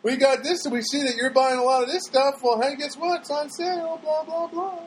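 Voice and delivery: high, chirpy ad pitch; mock upbeat sales voice; salesy marketer voice. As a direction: sell it like a pushy store mailer. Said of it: "so they can say".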